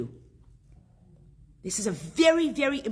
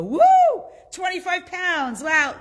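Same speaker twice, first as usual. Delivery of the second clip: higher, louder whoop of delight; mock excited whoop; 8 semitones higher, 8 dB louder than usual. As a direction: cheer like a gym newbie who thinks they lost weight overnight. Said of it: "walk out one day of gym and go".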